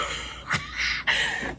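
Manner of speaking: high breathy squeal of laughter; shrieking laugh. Real, not acted. Cracking up at the customer calling her a pussy. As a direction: crack up helplessly.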